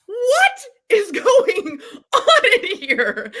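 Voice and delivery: high, slow, laughing cry of disbelief; half-laughing, half-crying at the chaos; a mock-horrified laughing wail. Retold shock at the biting.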